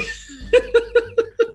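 shrill goofy cackle; silly high cackle. Shown as a demonstration. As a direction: laugh like a dumb witch. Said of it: "¿cómo se reía?".

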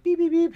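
high, loud mock droid beeps; droid beeping. R2 arriving at the tombstones, high mock beeps. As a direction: beep like a little droid. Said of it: "R two D two is like".

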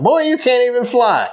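high scolding mother bird; mama bird voice. Retold or imitated. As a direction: talk down like a mother. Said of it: "She looked down at him".

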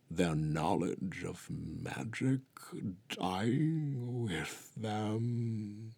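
put-on solemn old voice sinking to a whisper; grave, fading to whisper. A narrator's reading. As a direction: let it die away into a hushed whisper. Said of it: "she began gravely".

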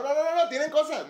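loud, high-pitched rapid nos; shouting to stop a friend juggling; alarmed no. Real, not acted. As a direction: cry out to stop him in time. Stops friend juggling messy items.

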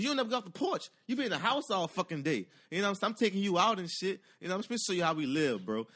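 high, excited cousin voice; teasing cousin calling him out; cousin's hype voice. Retold or imitated. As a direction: egg him on, voice jumping up high. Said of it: "He was like".

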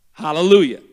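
normal talking voice

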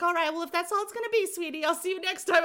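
mock cheerful phone agent hurrying off; chirpy caller sign-off. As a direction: rattle off the cheery goodbye script. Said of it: "She's like".

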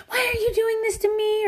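high-pitched mock parental whining; immature whine. Parent overreacting to the kids, immature high whine. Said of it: "any of the immature reactions".

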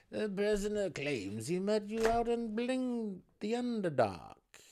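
put-on high, loud old gnome voice. A narrator's reading. Ancient gnome reporting the prisoner's claim to the warden, high voice. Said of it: "an ancient Swift Neblin said to Belwer".

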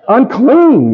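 Unclean hit high and loud; stress on unclean. Acted. Preacher mocking the word Unclean to the congregation. Mocking how he felt judged.

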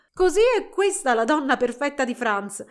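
mock it with amusement, without laughing; amused mockery. A narrator's reading. Friends ribbing the girl about the doll, amused. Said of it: "risero divertite le amiche".

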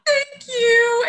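very high, crying thanks; sobbing gratitude; bawling thank you. Real, not acted. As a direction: thank her while breaking down in tears. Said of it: "And I just bawled".

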